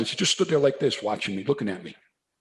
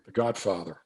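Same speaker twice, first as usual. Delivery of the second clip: ordinary talk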